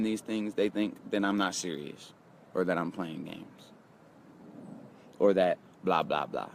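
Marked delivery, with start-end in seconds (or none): none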